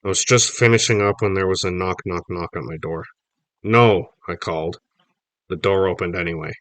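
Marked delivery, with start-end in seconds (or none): 3.6-4.1 loud sharp no, pitched up; shouted no. Acted. Boy yells at parents knocking at his door, loud. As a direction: yell it to keep them out.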